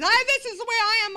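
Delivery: loud, high, fast tipsy declaration; a giddy drunken brag, high and loud; drunk boast. Retold or imitated. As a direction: belt it out like a drunk party girl.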